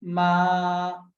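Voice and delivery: मा held long and level; first tone. Shown as a demonstration. Teacher repeating the example syllable, level tone on मा. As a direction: keep मा on one unbending note. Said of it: "first tone लगाइए".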